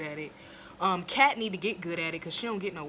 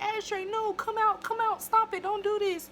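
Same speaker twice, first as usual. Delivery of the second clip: high, louder desperate begging; desperate pleading; 4 dB louder than usual. Retold or imitated. Fez banging on the bathroom door, high desperate plea.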